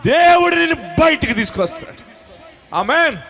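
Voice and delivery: shouted preaching climbing high and loud; preaching at full force. Acted. Pastor shouting deliverance over his congregation, preaching at full force. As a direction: cry out deliverance to the crowd. Preacher climaxing promise of release.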